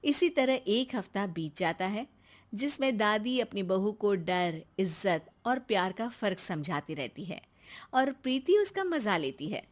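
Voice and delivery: ordinary delivery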